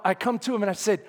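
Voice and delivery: ordinary talk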